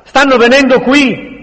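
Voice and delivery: high, loud alarm; panicked warning. A narrator's reading. Neri warns they are coming, panicked. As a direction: cry out that danger is close.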